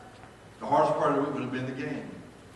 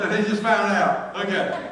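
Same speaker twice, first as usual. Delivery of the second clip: ordinary delivery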